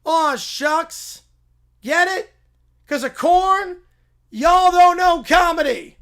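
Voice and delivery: hammed-up corny catchphrase. Farmhand cracking his corn joke, hammy and loud. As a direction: sell the corn pun like a hayseed comic.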